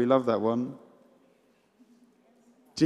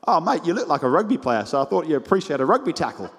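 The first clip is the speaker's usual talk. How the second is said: put-on Australian accent; 4 semitones higher, faster than usual. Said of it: "He was Australian".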